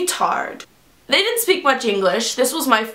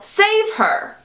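high-pitched mock stage yell; acted yell; 10 semitones higher than usual. Chorus member yelling a line on stage, mock yell. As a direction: cry it out like a dramatic chorus line. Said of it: "and yell".

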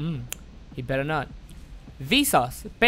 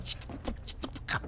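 whispering; 14 dB quieter, faster than usual. Real, not acted. Hiding from killer.

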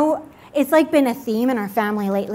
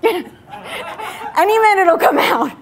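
high, louder, slow laughing mock warning; laughing mock threat; 7 semitones higher, 5 dB louder, slower than usual. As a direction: ham up a God about to blow, laughing. Voicing God about to explode.

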